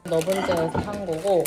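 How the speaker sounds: ordinary delivery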